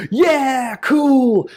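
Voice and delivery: high, loud excited whoop; hammed-up happy cheer; mock excited. Shown as a demonstration. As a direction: bounce with excitement. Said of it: "you might be excited".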